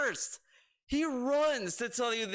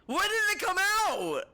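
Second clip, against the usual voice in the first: exasperated; 9 semitones higher, 5 dB louder, slower than usual. Real, not acted. Rant, question repeated.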